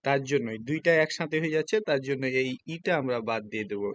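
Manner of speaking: ordinary talk, nothing marked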